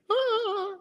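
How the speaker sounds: sudden high squeal of glee; high delighted shriek. Real, not acted. Host squealing at her own pun. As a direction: yelp at your own joke.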